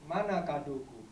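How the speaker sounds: slow, drawn-out lines with long pauses; poetry recitation. Acted. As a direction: recite it as a plea to Mama. Recites poem as child to mother.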